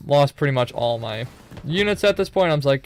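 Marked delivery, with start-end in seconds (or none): none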